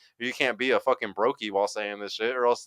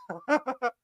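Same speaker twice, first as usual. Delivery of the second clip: high laugh; 4 dB quieter than usual. Real, not acted. Laughs at own chat reading.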